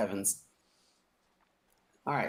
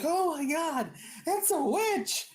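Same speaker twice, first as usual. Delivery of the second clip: much higher, panicked shriek; terrified cry; slower than usual. Acted. Captive sees the witch, high panicked cry.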